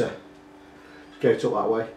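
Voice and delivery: ordinary talk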